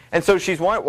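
normal talking voice

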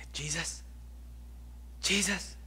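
hushed, hesitant calls to a sleeper; timid whisper. Acted. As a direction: softly try to wake someone, pausing between tries. Disciples waking sleeping Jesus timidly.

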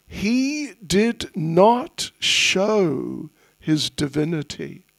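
slow and deliberate, each word weighed; slow emphasis. Shown as a demonstration. Teacher stressing that the boy hid his divinity, slow. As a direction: lay it down slowly.

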